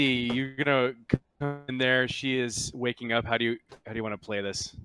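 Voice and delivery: ordinary talk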